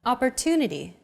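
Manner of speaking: "Opportunity" with TU stressed and held longer. Shown as a demonstration. Teacher alternates English with Arabic example, TU stressed in "Opportunity". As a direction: lean on the TU in "Opportunity". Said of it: "The stressed syllable to in opportunity".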